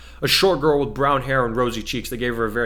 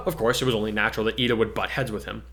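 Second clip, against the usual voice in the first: ordinary delivery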